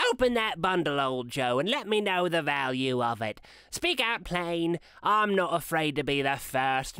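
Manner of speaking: high, cackling woman's voice; a thief dismissing guilt to Mrs Dilber; brash old woman. A narrator's reading. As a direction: toss it off like a hard old thief. Woman voiced, higher and louder.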